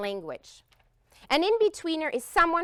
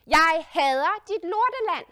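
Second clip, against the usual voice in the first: angry outburst in Danish; 3 semitones higher, 7 dB louder than usual. Retold or imitated. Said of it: "It was like this".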